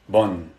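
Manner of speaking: "Bun" said low, closing firmly on the N; pronunciation model. Shown as a demonstration. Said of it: "we're gonna put an N".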